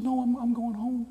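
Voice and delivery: put-on high old professor voice; old man's voice.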